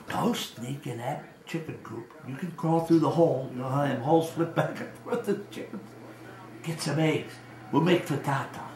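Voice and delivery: softly retold furtive instructions; imitating secretive grandma. Grandma secretly sends child for eggs.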